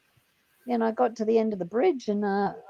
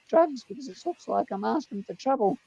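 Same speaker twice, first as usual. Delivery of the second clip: normal talking voice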